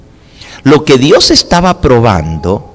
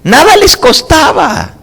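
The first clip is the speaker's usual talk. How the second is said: high emphatic exclamation; 4 dB louder than usual. Acted. Preacher's rhetorical peak.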